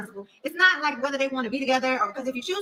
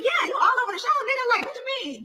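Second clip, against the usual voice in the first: excited retort; 11 semitones higher than usual. Retold or imitated. Retold reply to Dennis.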